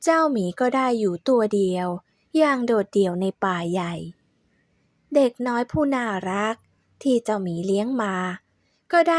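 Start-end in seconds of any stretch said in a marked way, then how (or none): none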